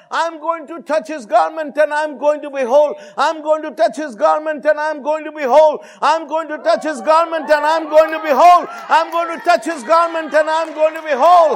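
relentless preacher's affirmation, rising to a shout; loud repeated declaration. Retold or imitated. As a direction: proclaim it over and over, building in force. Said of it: "said loudly, repeating to herself".

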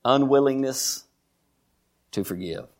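pause, then let the last words sink. Real, not acted. Drives home the point, pausing.

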